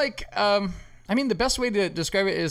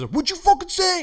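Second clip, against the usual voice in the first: higher, louder mock tough-guy challenge; threatening guy; 4 dB louder than usual. A dad confronting him at the pool, as a mock tough-guy challenge. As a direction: throw the challenge like a pool bully. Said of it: "the guy goes".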